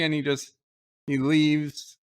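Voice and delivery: usual speaking voice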